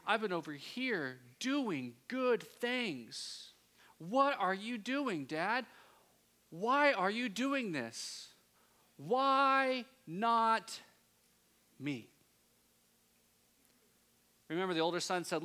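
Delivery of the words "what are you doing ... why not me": higher, slow, louder pleading complaint; aggrieved son crying out; indignant outcry. Retold or imitated. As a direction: plead your unfairness to Dad. Older son's indignant outcry.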